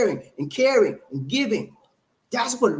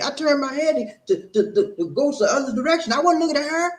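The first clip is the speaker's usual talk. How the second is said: higher, laughing protest of innocence; mock defensive boyfriend; 8 semitones higher than usual. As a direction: squirm out of it with a nervous laugh.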